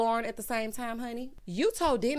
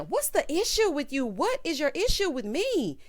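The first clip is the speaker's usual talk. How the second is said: high, heated mock demand; retold confrontational questioning; flying off handle; 6 semitones higher, 3 dB louder than usual. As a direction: imitate someone losing their temper, pitched up. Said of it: "Storm starts flying off the handle".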